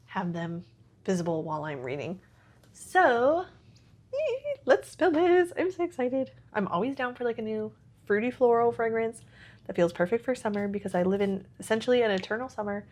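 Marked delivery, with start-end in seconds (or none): none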